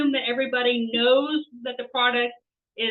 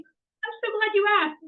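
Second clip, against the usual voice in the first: mock delighted; 9 semitones higher than usual. Answers her own staged question.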